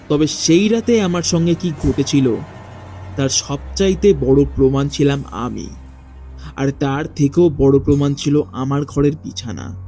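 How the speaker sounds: plain reading voice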